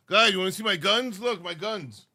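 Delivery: normal talking voice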